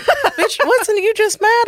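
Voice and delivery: incredulous friend. Retold or imitated.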